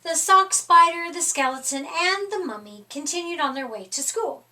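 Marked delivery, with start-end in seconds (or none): none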